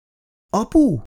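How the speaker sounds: put-on high-pitched little boy calling. A narrator's reading. Son calls to his father, high childlike voice. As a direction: call out high, like a small son. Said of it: "szólalt meg Ferkó".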